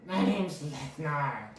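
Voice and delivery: high, slow goblin insisting. Acted. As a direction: goblin servant correcting his master. Goblin voices his name.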